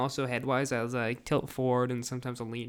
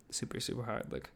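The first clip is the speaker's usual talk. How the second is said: turned away from the microphone; faint, off mic; 2 semitones lower, 9 dB quieter than usual. Real, not acted. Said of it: "I'm sorry if I sound faint".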